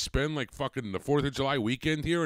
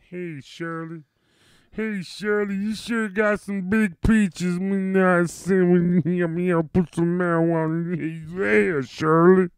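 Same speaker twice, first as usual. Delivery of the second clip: high, loud, hammed-up Bookman chatting up Shirley; Bookman hitting on Shirley; 6 semitones higher, 8 dB louder, slower than usual. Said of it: "He was always trying to bang Shirley".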